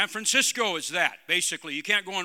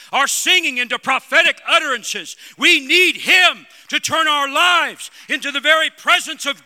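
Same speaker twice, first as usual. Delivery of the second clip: loud, climbing preacher's cry, higher and louder; full-force preaching; 4 semitones higher, 9 dB louder than usual. Acted. Preacher rallying the church, shouting at full force. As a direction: build the congregation to a roaring peak.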